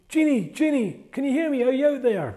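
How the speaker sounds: high, loud call up into the sky. Acted. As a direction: shout up to summon the genie. Rain cloud calls for genie.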